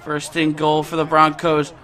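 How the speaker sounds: usual speaking voice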